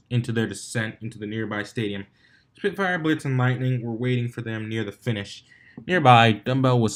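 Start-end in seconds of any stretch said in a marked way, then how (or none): none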